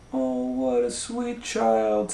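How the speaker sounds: high, slow and gushing; sweet townsfolk voice. A narrator's reading. Newcomer in town praising Goldilocks, high gushing coo. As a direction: coo over the child like a charmed newcomer. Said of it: "said someone new in town".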